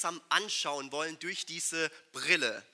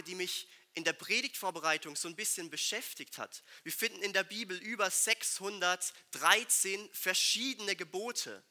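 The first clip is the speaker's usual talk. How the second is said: ordinary talk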